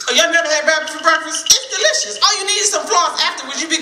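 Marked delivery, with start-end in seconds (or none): none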